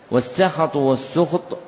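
والسخط said first as "sukht" with the sin given damma, then as "sakhat" with fatha; two readings shown. Shown as a demonstration. Teacher shows students both readings of والسخط, sukht then sakhat. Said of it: "فالسخط تضم سينه وتفتح".

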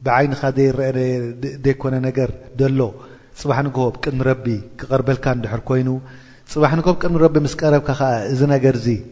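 ordinary talk

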